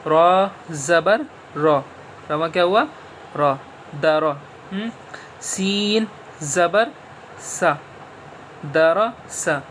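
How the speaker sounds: slow, syllable by syllable letter drill; slow spelling drill. Shown as a demonstration. Teacher drilling zabar letters for pupils, slow and deliberate. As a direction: spell each letter out slowly for children. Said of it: "پہلے آپ کو یہ پڑھنا ہے".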